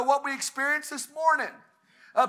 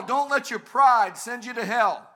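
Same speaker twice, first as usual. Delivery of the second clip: ordinary talk, nothing marked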